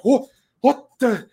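high, startled gasp of disbelief; surprised. Retold or imitated. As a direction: caught off guard mid-rally. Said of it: "I'm like".

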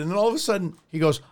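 ordinary delivery

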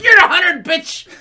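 very loud, high comic punchline; shouted put-down. Acted. Comic mocking the ticket seller, shouted put-down. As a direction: belt out the mock insult.

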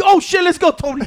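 loud, high reenactment of egging him on; excited hype-up. Retold or imitated. Retelling himself urging Tony into the match, excited shout. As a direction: imitate firing up a teammate.

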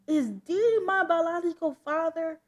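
urgent question. Acted. Daughter confronting parents about her birth father, urgent. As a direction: put it to them suddenly and loudly.

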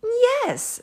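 high, loud happy yes; bright yes. A narrator's reading. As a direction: sing out yes gladly. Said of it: "said the witch".

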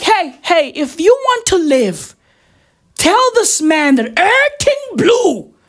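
high-pitched, urgent imitated plea; hammed-up high warning; mock urgent warning. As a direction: warn a colleague as if lives depend on it. Acted urgent warning to colleagues.

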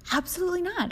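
high, loud, peppy mock cheer; hammed-up enthusiastic texter; mock over-eager. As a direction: bounce it like an overexcited puppy. Reading the over-eager guy's text.

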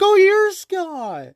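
high, drawn-out mock exclamation of recognition; older lady. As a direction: imitate an older lady's long, high "oh". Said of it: "She's like".

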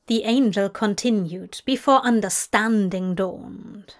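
usual speaking voice

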